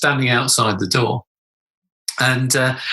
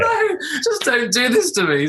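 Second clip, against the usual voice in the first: high, slow plea through laughter; laughing despair; 10 semitones higher than usual. Retold or imitated. Dad's thought as daughter keeps asking, laughing despair. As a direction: wail it helplessly, half laughing. Repeats retold horror, laughing.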